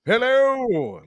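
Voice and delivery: loud, high, drawn-out heroic greeting; sexy strong hero. Shown as a demonstration. Voice actor demonstrating hero roles, sexy strong hero. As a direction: call out like a dashing hero. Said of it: "Like that guy, that guy who's sexy and, and strong".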